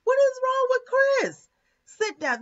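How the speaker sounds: retold shock, then a curt "sit down"; incredulous outburst. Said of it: "and I'm like".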